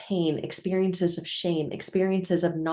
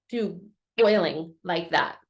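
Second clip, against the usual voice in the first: ordinary talk, nothing marked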